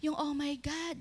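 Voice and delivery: softly whispered "Oh my God". Retold or imitated. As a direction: breathe it out like a secret. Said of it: "Ganun pa bulong". (pabulong na sinabi)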